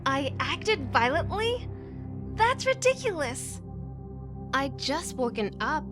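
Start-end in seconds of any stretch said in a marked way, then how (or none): 0.0-3.6 rising incredulous question, then high-pitched protest; indignant disbelief. Acted. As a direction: throw the accusation back, offended. Accused of violence, protests indignantly.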